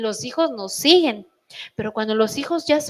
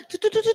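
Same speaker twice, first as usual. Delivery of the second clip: sputtering gasp; 10 semitones higher, faster than usual. Retold or imitated. Mother losing her temper at mess.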